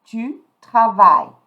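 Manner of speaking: "Tu travailles" loudly modelled, ending on "vai"; silent final ES. Shown as a demonstration. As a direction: sound out "travailles" without E or S. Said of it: "I'm not going to pronounce the S".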